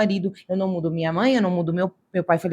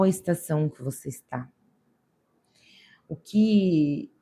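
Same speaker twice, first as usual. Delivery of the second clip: lower, softer, slow counsel; soft earnest counsel; 5 semitones lower, 4 dB quieter than usual. Real, not acted. Believer guiding viewers to prayer, lower and softer. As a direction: gently lead them to pray.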